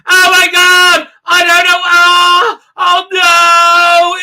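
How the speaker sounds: high, loud mock panic shrieks; hammed-up terror, yelling over the chaos; panicked screaming. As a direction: crew member yelling in mock panic as the shuttle gets trapped. Crew panicking in episode.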